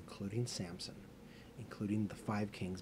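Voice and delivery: usual speaking voice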